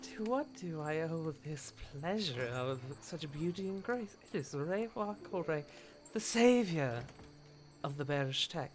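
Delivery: soft, sultry hostess welcome; flirtatious geisha. Acted. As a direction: purr the welcome. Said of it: "and she goes".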